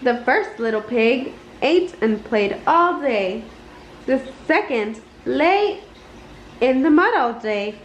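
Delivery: usual speaking voice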